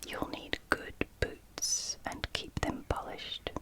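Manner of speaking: ordinary talk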